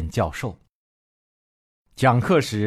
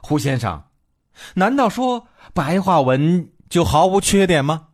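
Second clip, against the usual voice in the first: indignant student's challenge, pitched up; narrated angry student, higher-pitched; angry questioning; 7 semitones higher than usual. A narrator's reading. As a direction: demand an answer with rising indignation. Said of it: "生气地问".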